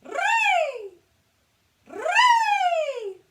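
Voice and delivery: very high, very loud trilled ree; strong head resonance. Shown as a demonstration. Coach demonstrating strong head resonance, piercing high ree. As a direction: ring ree out at the top of the head. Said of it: "really strong head resonance".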